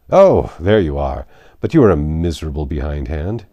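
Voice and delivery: loud exclamation. A narrator's reading. Knightley bursts out, face flushed.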